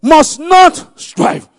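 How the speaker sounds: high, very loud preaching voice; forceful scripture reading. Real, not acted. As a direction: proclaim the verse at full force. Preacher reads scripture at full force.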